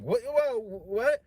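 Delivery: high, loud startled yelp. Retold or imitated. Host startled by the refusal, yelping in surprise. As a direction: jump back in shock. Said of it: "he's like".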